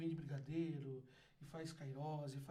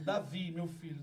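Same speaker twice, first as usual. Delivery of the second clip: usual speaking voice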